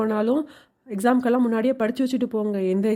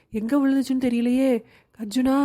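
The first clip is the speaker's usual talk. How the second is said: normal talking voice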